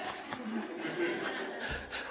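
high giggle after the squeaky voice; high giggling laugh. Real, not acted. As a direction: laugh at the joke. Laughing at own impression.

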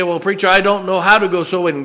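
high, loud whiny church member; mock congregant excuse. Preacher mocking a churchgoer's excuse to the congregation, loud and whiny. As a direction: mimic a reluctant churchgoer making excuses. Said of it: "you say".

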